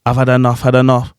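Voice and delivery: restrained, unexcited lyric read; low-energy delivery. Shown as a demonstration. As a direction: say the lyric evenly, with little lift. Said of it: "If I say it like this".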